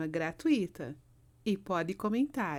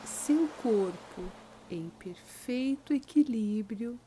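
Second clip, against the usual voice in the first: slow meditation voice with long pauses; unhurried, calming relaxation guide; guided sleep meditation; 4 dB quieter, slower than usual. Acted. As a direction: lead the listener gently into sleep, phrase by phrase.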